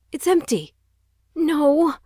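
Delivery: panicked. Acted. Empty weapon case, panic.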